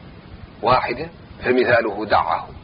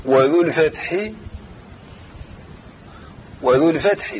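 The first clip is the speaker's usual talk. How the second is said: verse recitation; 4 dB quieter than usual. Shown as a demonstration. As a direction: chant the verse line, drawing out each phrase. Teacher chants the verse line. (تلاوة نظم بتمهل)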